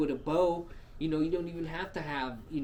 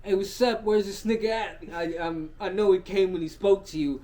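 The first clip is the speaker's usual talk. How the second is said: loud, raised demanding voice; acted confrontation; 5 semitones higher, 8 dB louder than usual. Homegirl goes to her dad, loud and demanding. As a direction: call Dad out, mock-indignant.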